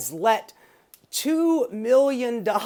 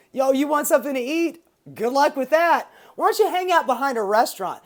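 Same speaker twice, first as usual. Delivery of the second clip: higher, fast taunting voice; hammed-up sneering mockery; mocking sneer; 7 semitones higher, 5 dB louder, faster than usual. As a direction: taunt the poor like a smug politician. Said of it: "They are basically telling".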